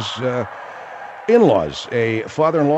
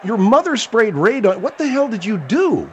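higher-pitched incredulous outburst; interrupt, pitched up in disbelief; astonished, demanding question; shocked disbelief; 7 semitones higher than usual. Real, not acted. Host reacts to shocking story.

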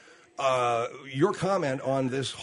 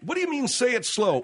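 exasperated; 7 semitones higher than usual. Real, not acted. Exasperated at absurd demand.

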